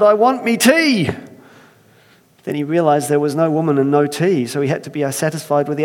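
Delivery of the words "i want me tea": loud, higher Irish demand for tea. Acted. First man hungry, shouting in Irish accent. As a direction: bark for your tea in an Irish lilt. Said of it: "that's how it's written".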